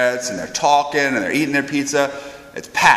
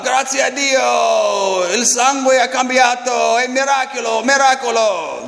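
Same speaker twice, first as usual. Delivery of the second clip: jubilant Italian shouting; 9 semitones higher than usual. Retold or imitated. Neapolitan crowd hailing the miracle, high joyful shouting. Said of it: "They're screaming and yelling".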